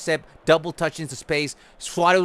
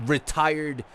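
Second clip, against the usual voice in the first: angry rant; 6 dB louder than usual. Real, not acted. Ranting at teammates passing.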